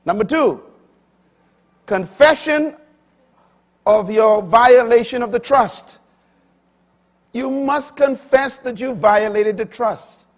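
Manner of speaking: high and loud, point by point; preaching. Acted. Preacher listing his points to the congregation, forceful. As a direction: drive home the second point.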